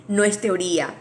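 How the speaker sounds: ordinary talk, nothing marked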